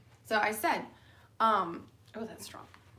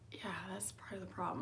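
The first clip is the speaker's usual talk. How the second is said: breathy hushed aside, much softer; whispered aside; 11 dB quieter, faster than usual. Retold or imitated. Whispered aside in retold chat.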